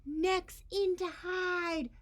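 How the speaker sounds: high, loud, slow screech of alarm; screeched warning. Acted. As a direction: shriek it as a panicked warning. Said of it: "the Wiggleskins all screeched".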